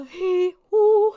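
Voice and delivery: high, loud whooping hee, hoo; loud hee-hoo noises. Shown as a demonstration. Streamer demonstrating noises to mask copyrighted music, loud hee-hoo. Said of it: "and go, uh".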